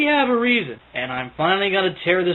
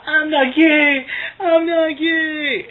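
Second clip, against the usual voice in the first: high, drawn-out mock wail; Chris Chan; 6 semitones higher, much slower than usual. Commentator imitates Chris shouting in hell, mock wail. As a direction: wail it slowly in a higher mocking voice. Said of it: "And Chris will shout".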